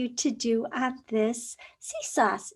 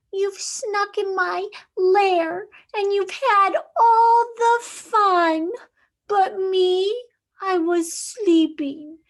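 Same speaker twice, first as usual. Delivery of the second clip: louder, whiny complaint; upset bear; 4 semitones higher, 6 dB louder than usual. Acted. Bear complaining to the party animals, louder upset whine. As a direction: complain like a hurt grumpy bear. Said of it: "it says".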